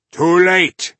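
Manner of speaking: loud, high taunt from the dark. Acted. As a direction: call it out smugly. Voice in the darkness.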